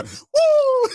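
mock woo. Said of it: "Martin Lawrence is like".

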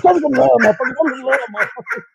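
high-pitched fan shout; hyped shout. Retold or imitated. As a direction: yell it like you just spotted your idol. Greeting [name] at the door.

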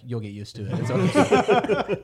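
imitated reassurance breaking into laughter; teasing retold comfort, ending high; mock consoling. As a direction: console slowly, cracking up at the end. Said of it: "He's like".

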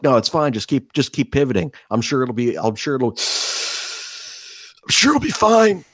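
imitating strained buddy. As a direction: play him crushed but reassuring, last words drawn out high. Acts crushed buddy insisting he's fine.

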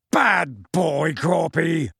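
drawn-out yelled reprimand; Hagrid yelling. Acted. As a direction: tell off a giant like a naughty dog. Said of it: "Harry heard Hagrid yelling".

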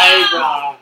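loud, punchy hey brah; shouted catchphrase. Real, not acted. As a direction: call it out to the room.

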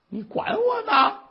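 high, loud indignant retort; cantankerous old woman. Acted. Sick old woman rebuffing those asking after her, indignant. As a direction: play a peevish old patient lashing out. Sick mother snaps at neighbours.

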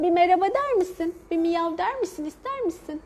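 high coaxing pet voice. Real, not acted. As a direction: coax the cat sweetly. Asking the cat to meow.

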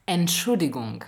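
normal talking voice

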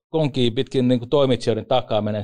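ordinary delivery

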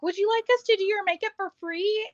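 salesperson sweetness. Retold or imitated. Dillard's clerk offering makeup, high and sugary. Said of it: "and they're like".

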